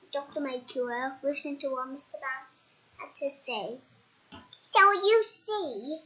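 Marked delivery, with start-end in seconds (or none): 4.6-6.0 explaining. Acted. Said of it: "said Mister Bounce".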